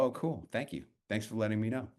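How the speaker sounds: softly retold "Oh, cool. Thank you."; mild quiet brush-off. Retelling his brushed-off reply.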